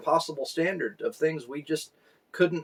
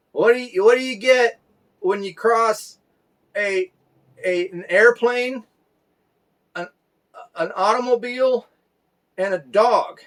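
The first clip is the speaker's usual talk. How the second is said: put-on higher, louder halting child voice; little son's riddle; 8 semitones higher, 6 dB louder than usual. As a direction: ask the riddle like an eager toddler. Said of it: "He said".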